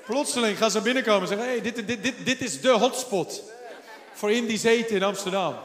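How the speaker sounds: loud, rousing proclamation; excited preaching. Real, not acted. Pastor prophesying crowds at the restaurant, loud excited preaching. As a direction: shout the promise with joy.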